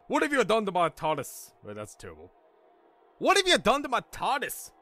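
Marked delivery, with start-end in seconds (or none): 0.1-1.5 put-on mildly angry English accent, raised pitch; a restrained first go at outraged bellowing; half-hearted bellow. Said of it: "bellowed at Doc in an angry English accent". 3.2-4.7 high-pitched outraged English outburst; angry English bellow. Acted. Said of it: "bellowed at Doc in an angry English accent".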